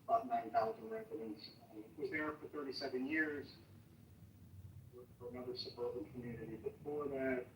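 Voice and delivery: muffled, far from the microphone; distant, muddy mic sound; muddy boomy voice. Real, not acted. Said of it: "You sound a little muddy".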